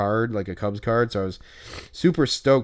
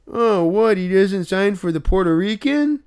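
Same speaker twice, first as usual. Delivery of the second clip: high put-on voice of a miffed fan; hammed-up annoyed fan; mock indignation; 8 semitones higher, 4 dB louder than usual. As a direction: imitate the guy, higher and outraged. Mocking the autograph hunter's complaint.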